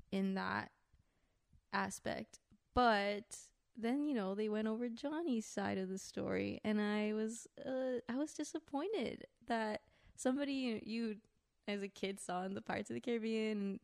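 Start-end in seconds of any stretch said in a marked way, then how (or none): none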